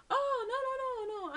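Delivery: high, loud string of denials; re-enacted yelp of mistaken identity; alarmed protest. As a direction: hurriedly put someone right, panicked. Retold alarmed denial to buddy.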